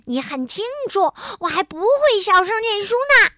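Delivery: high, loud indignant protest. A narrator's reading. New reader defends reading aloud, shouting. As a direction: yell it in self-defence. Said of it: "提高嗓门喊道".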